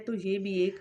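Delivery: ordinary talk, nothing marked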